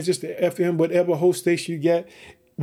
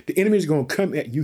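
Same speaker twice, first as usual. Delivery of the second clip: ordinary talk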